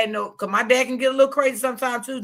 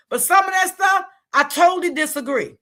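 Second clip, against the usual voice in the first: raised voice; 8 semitones higher than usual. Real, not acted. Raising voice in disagreement.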